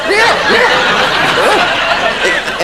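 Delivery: real laughter, very high and loud; laughing. Real, not acted. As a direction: laugh through yeah yeah. Laughing after own parody.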